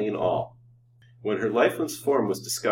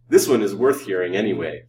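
loud, grim assurance; 8 dB louder than usual. A narrator's reading. Doctor promises his secret is worth hearing, grimly. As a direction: assert it grimly. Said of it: "said Doctor Pratt grimly".

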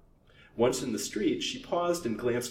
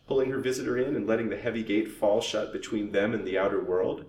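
plain reading voice

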